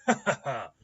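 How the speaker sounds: loud, high stage laugh; delighted laugh. A narrator's reading. Rigging doctor enjoying his own joke, laughing delightedly. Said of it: "Ambrose chuckles delightedly".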